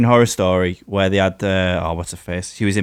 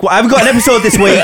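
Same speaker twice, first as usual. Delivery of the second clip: higher, fast, laughing mock excuse; mock whiny excuse; 10 semitones higher, 4 dB louder, faster than usual. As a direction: toss off a sulky excuse through a laugh. Mocking a complaining colleague.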